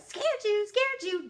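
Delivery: loud, high-pitched sing-out; gleeful taunt. A narrator's reading. Little sister leaps out at big sister, loud high taunt. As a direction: crow it like a kid who just jumped out.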